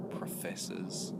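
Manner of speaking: normal talking voice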